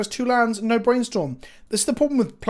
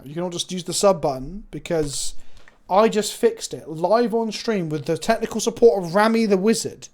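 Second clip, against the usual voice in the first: ordinary talk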